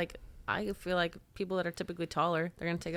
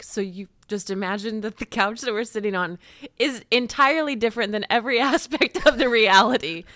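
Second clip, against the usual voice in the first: louder and higher, building into laughter; mock-outraged disbelief, breaking into a laugh; incredulous retort; 4 semitones higher, 9 dB louder than usual. Customer retells challenging the couch saleswoman, incredulous.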